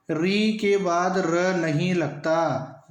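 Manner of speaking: री के बाद र नहीं लगता spelled out slowly, every word weighted; teacher stressing the spelling rule; slow emphasis. Shown as a demonstration. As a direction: lay down the rule slowly and firmly. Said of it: "ध्यान दें".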